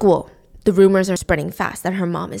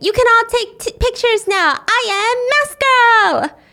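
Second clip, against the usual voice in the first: put-on much higher, proud little-girl announcement, slowing at the end; a seven-year-old proudly playing her mother's role; child posing as Mask Girl; 11 semitones higher, 3 dB louder than usual. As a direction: show off like a kid in costume. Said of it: "with the mask on saying".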